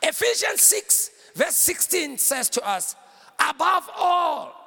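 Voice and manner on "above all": slow, stretched emphatic preaching; ringing scripture quote; declaimed scripture. Real, not acted. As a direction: draw out each word with force.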